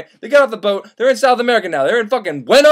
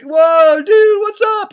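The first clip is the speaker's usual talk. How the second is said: high, loud delighted whoa; surprised greeting; 11 semitones higher, 4 dB louder, slower than usual. Retold or imitated. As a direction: shout a happy hello to an old friend. Surprised greeting of old friend.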